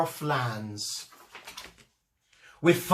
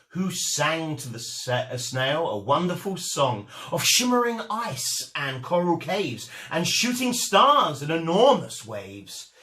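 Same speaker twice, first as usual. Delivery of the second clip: ordinary delivery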